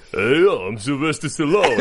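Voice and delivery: Stallone impression. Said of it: "your part of the impression".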